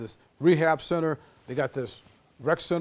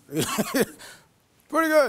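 sleepy Hank; 11 semitones higher, much slower than usual. Retold or imitated. Hank answers from bed.

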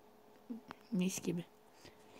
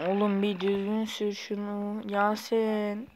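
ordinary talk

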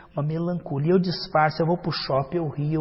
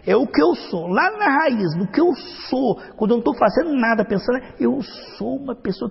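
higher and louder, stressing "eu"; emphatic preaching; 6 semitones higher, 5 dB louder than usual. Real, not acted. Preacher stressing the root of the self, loud and high. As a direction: hammer the point home.

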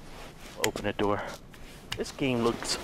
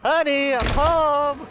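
sitcom dad greeting; 6 semitones higher, 4 dB louder, slower than usual. Acted.

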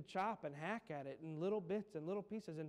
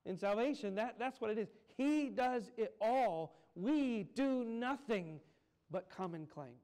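higher, louder and slow, every word driven home; fervent preaching; 6 semitones higher, 7 dB louder than usual. Acted. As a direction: proclaim it slowly from the pulpit at full force. Preaching climax on grace.

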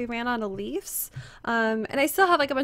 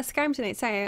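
ordinary talk, nothing marked

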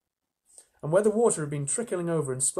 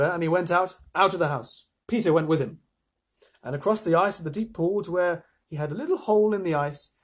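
ordinary delivery

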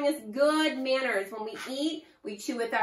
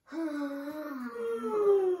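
big yawn; 3 semitones higher, 3 dB quieter than usual. Shown as a demonstration. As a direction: let out a big open-mouthed yawn. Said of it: "I need you to yawn".

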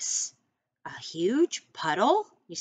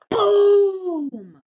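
booming; 12 semitones higher, 11 dB louder than usual. Shown as a demonstration. Teacher showing a child how to read boom with feeling, a loud boom. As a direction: boom shouted high and very loud. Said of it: "say it with feeling".

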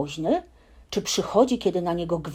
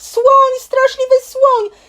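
much higher, louder frantic screams for help; screaming; 11 dB louder, slower than usual. Acted. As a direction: shriek for help in a panic. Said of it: "krzyczał prosiaczek".

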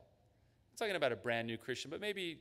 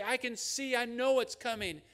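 mocking, much higher and louder know-it-all; smug confidence; 10 semitones higher, 5 dB louder than usual. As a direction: play the blind man who insists he sees. Voicing the smug listener.

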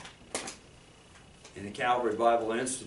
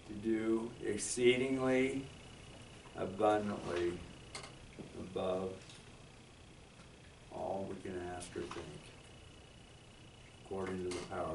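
solemn scripture reading; 3 semitones lower than usual. Acted. Reciting benediction verse.

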